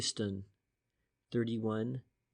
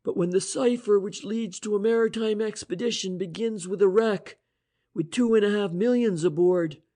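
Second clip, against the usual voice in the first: higher, louder voiced inspector, eager reasoning; Inspector French voice; 8 semitones higher, 7 dB louder than usual. The inspector explaining the cipher to Price and Shane, eager. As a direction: lay out the theory with rising energy.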